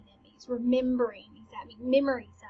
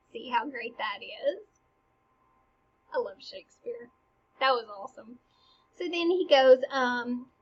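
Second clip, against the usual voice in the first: ordinary delivery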